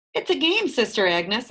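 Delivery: called cheerfully across the train car; calling out. A narrator's reading. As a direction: call out to cover it up. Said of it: "I call out".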